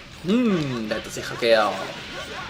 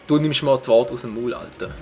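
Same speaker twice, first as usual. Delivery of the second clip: ordinary talk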